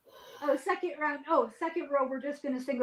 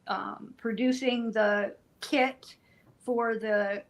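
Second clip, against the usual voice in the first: normal talking voice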